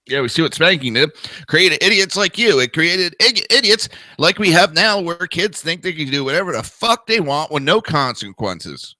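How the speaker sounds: heated rebuttal to anti-spanking critics; angry retort. Real, not acted. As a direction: snap back at the critics with real heat.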